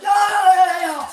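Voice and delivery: high, loud retold shout of yo; loud high yell breaking up a fight. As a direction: shout them down at full volume. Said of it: "I said".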